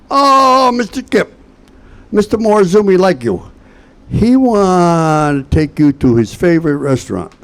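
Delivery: high-pitched mock translator, easing down; hammed-up eager caller with a long high opening; excited translator. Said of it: "the translator. And they called me".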